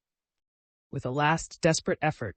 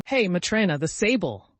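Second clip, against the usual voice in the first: louder call across the rooms; shouted; 5 semitones higher, 5 dB louder than usual. A narrator's reading. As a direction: call out so it rings through the house. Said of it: "he shouted so that his voice rang".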